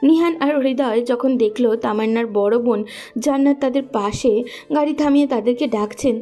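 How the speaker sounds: usual speaking voice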